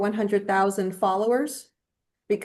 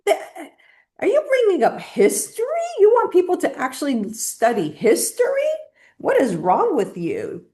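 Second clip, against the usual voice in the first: playful fake indignation; mock outrage; 7 semitones higher than usual. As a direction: ham up being appalled at the idea. Sarcastic reply to history quip.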